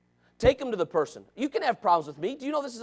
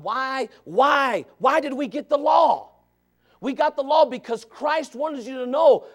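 high, loud, urgent pulpit exhortation; full-force preaching; 8 semitones higher, 9 dB louder, slower than usual. Real, not acted. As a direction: hammer out the question, then the answer.

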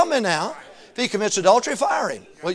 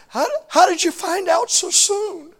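put-on kid voice; 12 semitones higher, 8 dB louder, slower than usual. Scared kid voiced in story.

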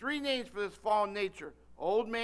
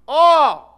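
loud, higher groan of pain; sharp loud oh of pain; 5 semitones higher, 22 dB louder than usual. Retold or imitated. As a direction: cry out as the finger gets caught. Remembered pain.

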